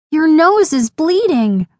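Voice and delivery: high-pitched startled cry; alarmed exclamation. A narrator's reading. Lainey spots her friend's nosebleed, high startled exclamation. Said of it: "Lainey exclaimed".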